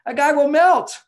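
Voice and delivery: high, loud burst of delight. Real, not acted. Advice giver to host about a man's reaction, excited. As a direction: sell the payoff with a big excited lift.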